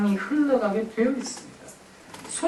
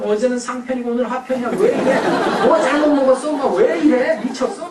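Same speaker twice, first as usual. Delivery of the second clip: put-on snappy, louder kid lashing out, fast; irritated teen; 3 semitones higher, 4 dB louder than usual. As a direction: sneer at mom's odd behavior. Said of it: "승질낼지도 몰라요". (짜증내며 쏘아붙이는 아이)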